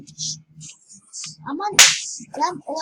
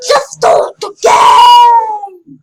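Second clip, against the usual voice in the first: frustrated shout; 7 semitones higher, 14 dB louder, much slower than usual. Real, not acted. Impatient for game to start.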